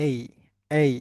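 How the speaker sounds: এই with a gliding "ay" vowel; correct English letter A. Shown as a demonstration. Teacher models letter A for class, correct pronunciation. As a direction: say এই as a clear two-part English A. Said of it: "এটাকে আমরা এখন থেকে কি বলবো".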